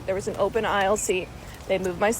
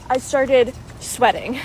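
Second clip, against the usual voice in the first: ordinary talk, nothing marked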